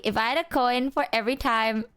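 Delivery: usual speaking voice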